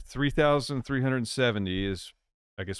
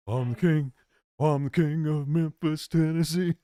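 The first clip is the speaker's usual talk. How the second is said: loud, higher-pitched showman impression; 6 semitones higher, 5 dB louder than usual. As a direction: proclaim it big, drawing out the words. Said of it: "Somebody else said I sound like [name]".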